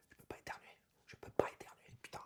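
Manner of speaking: voiceless, hushed whisper of a panicked animal; desperate whisper. Acted. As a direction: whisper it as if confiding a shameful failure. Lycaon unable to sneeze, panicking. (chuchotement affolé)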